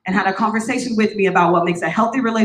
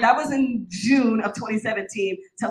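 usual speaking voice

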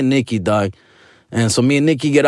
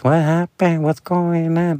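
high-pitched imitated questioning; bewildered companion; 4 semitones higher, slower than usual. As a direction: put on a high, confused voice. Voicing companion Nikki's bewildered questions.

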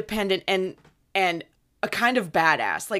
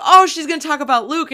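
excited; 7 semitones higher, 9 dB louder, faster than usual. Retold or imitated. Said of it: "we were like".

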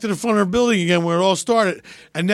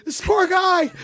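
lightly mocked alarmed bystander, pitched up; horrified onlooker; 8 semitones higher than usual. Voicing horrified onlookers of the stunts.

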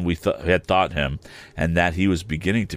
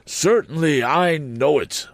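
high, pompous Mr Wopsle voice; fussy pompous man; 10 semitones higher, slower than usual. A narrator's reading. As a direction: raise the pitch, puff it up.

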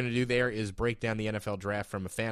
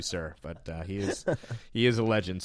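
normal talking voice

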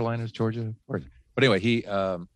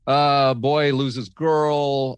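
slow summary; 5 semitones higher, 4 dB louder, much slower than usual. Shown as a demonstration. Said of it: "What is it?".

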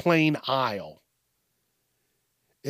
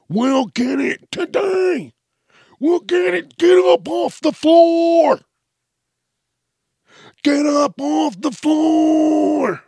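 high, slow, loud fed-up yell; exasperated parent yell; 12 semitones higher, 7 dB louder, slower than usual. Retold or imitated. Comedian playing a parent yelling at a tantruming kid, high and loud. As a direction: yell at the kid to get up, giving in.

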